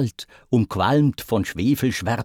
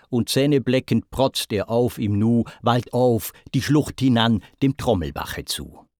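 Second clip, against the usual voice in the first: ordinary delivery, nothing marked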